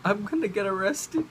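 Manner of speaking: high, tearful little-girl imitation; mock bawling. Crying classmate telling the teacher, high mock bawling. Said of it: "She's bawling her eyes up".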